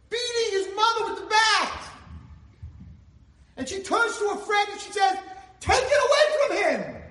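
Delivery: high, loud, alarmed outcry; horrified shout. Acted. Friend of the beaten mother crying out, shocked and loud. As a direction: yell it like you can't believe what you see.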